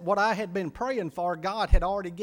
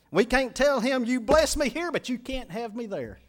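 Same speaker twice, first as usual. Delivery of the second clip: higher, louder preacher's cry; fiery preaching; 6 semitones higher, 5 dB louder than usual. Acted. Preacher warning his congregation, loud and high. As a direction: hammer the point home to the pews.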